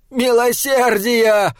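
slow desperate wail; wailing cry. Acted. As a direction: cry out for mercy. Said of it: "возопили они".

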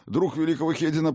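high, fast gushing praise; fawning innkeeper. Acted. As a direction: rush the praise, pitched high. Fawning take.

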